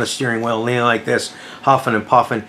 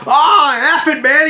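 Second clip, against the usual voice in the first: high, raised-voice giving up; acted exasperated yell; 14 semitones higher, 4 dB louder, slower than usual. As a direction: throw in the towel, yelling. Medic gives up during shouting match.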